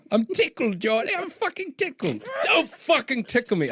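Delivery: high, squealing mock protest through laughter; hammed-up tickled. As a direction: protest as if being tickled, pitch shooting way up. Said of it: "I'm actually just tickled".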